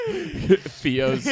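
slow, laughing delivery. Real, not acted. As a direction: chuckle through the line. Laughing at the joke.

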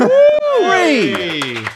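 high, loud celebratory whoop; cheering. Real, not acted. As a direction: cheer him on like a crowd. Cheering for the sound man.

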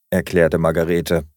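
usual speaking voice

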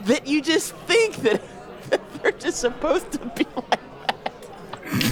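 high, breathy laughter through words; laughing. Real, not acted.

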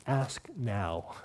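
a gentle, intimate plea; soft invitation. Acted.

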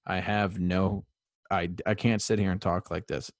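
ordinary talk

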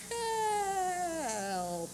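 faint, drawn-out dying cry for help; feeble cry. Acted. The dying leaven's last feeble call, soft and drawn out. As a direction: let it fade to almost nothing. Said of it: "the last feeble cry".

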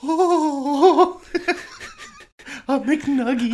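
high, laughing squeal of delight; squealing mock tourist, laughing through it; mock excited American. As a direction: gush over the nuggets in a silly high voice. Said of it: "Americans are like".

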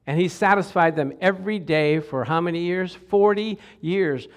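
normal talking voice

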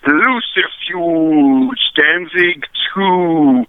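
slow, drawn-out cartoon ghost villain. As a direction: stretch each word like a Scooby-Doo ghost. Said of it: "you make it sound like a ghost villain off of Scooby-Doo".

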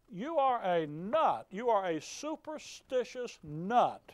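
slow, leaning on "are"; slow scornful verdict. Real, not acted. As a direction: drag out "You are a nut" with contempt.

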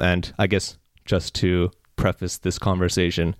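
normal talking voice

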